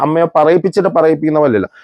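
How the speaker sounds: normal talking voice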